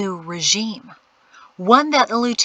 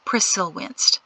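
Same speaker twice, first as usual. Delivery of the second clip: ordinary delivery, nothing marked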